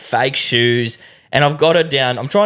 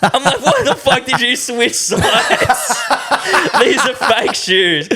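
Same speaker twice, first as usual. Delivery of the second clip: laughing; 10 semitones higher than usual. Real, not acted. Laughing retelling wife switching sides.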